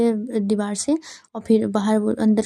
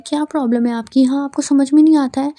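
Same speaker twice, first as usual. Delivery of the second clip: annoyed scolding; 4 semitones higher, 7 dB louder than usual. Retold or imitated. Ayza scolds Bilal after pushing him.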